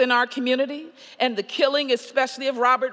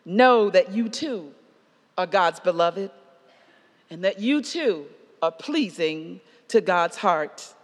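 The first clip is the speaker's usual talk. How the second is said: earnest preaching; 3 semitones lower than usual. Acted. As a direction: speak blessing gently over the congregation. Preaching conclusion, soft and earnest.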